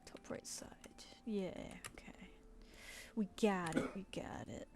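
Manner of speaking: quiet whisper to herself. Real, not acted. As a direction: murmur while concentrating.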